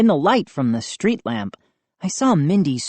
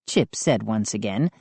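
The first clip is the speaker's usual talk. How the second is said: ordinary delivery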